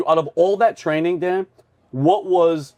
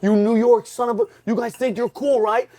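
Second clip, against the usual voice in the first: higher-pitched mock drill-instructor taunt; retold yell, raised in pitch more than volume; 7 semitones higher than usual. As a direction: imitate an instructor dressing down a recruit. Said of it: "they're all yelling with their hats".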